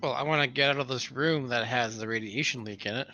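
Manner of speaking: usual speaking voice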